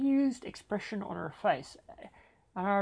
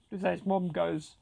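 ordinary talk, nothing marked

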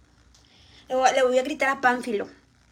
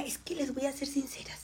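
whisper; 11 dB quieter than usual. Real, not acted. Confiding aside to viewers.

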